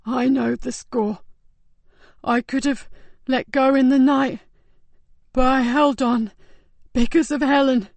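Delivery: weak, slow, gasping dying man; gasped out. A narrator's reading. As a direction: push each word out with failing breath. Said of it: "then gasped out".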